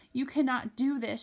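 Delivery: ordinary delivery, nothing marked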